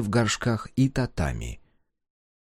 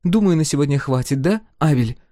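put-on higher, firm young woman; 8 semitones higher, 5 dB louder than usual. A narrator's reading. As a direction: calmly shut the quarrel down. Sol voiced, firm.

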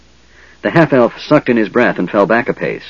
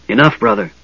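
sharp, higher cutting command; whip-like rebuke; 6 semitones higher than usual. A narrator's reading. Mage silencing his brother, a sharp whip-crack rebuke. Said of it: "soft voice flicked like a whip".

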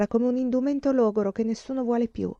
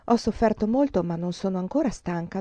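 plain reading voice

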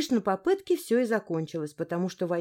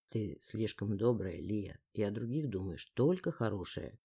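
faint memory echoing in her head; quiet echo; 11 semitones lower, 7 dB quieter than usual. A narrator's reading. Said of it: "Тихим эхом прозвучало в голове". (тихое эхо в голове)

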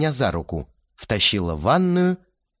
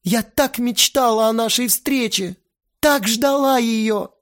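high, slowing, yearning voice; pleading girl; 12 semitones higher, 4 dB louder than usual. A narrator's reading. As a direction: stretch out the longing. Lena pleading.

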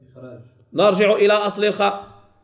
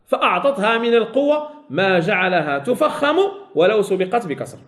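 ordinary talk, nothing marked

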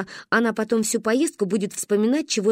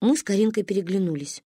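usual speaking voice

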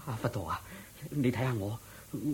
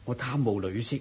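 ordinary talk, nothing marked

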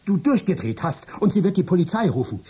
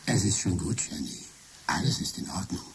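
much softer, lower and calm fake Michael; computer faking Michael; 8 semitones lower, 7 dB quieter than usual. Computer as Michael calming Jenny, soft and low. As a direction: soothe the wife in a borrowed voice. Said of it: "Ich kann deine Stimme imitieren".

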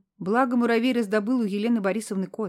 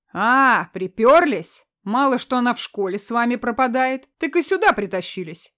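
angry sneer; 3 semitones higher, 6 dB louder than usual. Acted. Rude man confronting kids.

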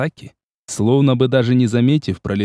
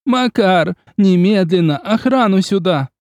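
loud high call; 8 semitones higher, 4 dB louder than usual. A narrator's reading. Agnes ordering Makar to fetch guards, shouting. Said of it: "громко и визгливо закричала Агнес".

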